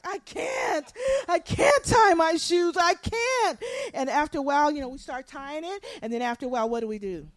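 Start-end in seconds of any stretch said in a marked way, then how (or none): 0.0-3.6 high, wailing mock child's tantrum; whining child. Parent imitates children struggling with their shoes, whining mock wail. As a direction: cry it out like a helpless little kid. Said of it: "our children are like".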